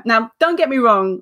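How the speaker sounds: usual speaking voice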